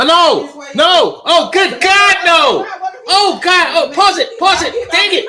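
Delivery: high, loud panicked shouting; horrified outburst. Real, not acted. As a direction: yell in hammed-up horror, pitch leaping way up. Mock horror at shocking clip.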